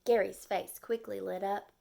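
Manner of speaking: plain reading voice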